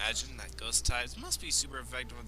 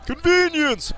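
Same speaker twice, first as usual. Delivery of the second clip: mocking; 13 semitones higher, 9 dB louder, slower than usual. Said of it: "before you say".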